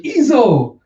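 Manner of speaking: high, loud exclamation of a name; amazed cry. Acted. Samurai spotting returned Izo, amazed high cry. Calling out a long-lost comrade's name.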